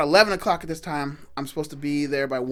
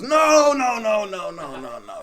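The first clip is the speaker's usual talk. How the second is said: string of protests fading away; retold emphatic objection; 7 semitones higher, 5 dB louder, slower than usual. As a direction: burst in high and loud, then tumble down. Retold second man contradicts the first.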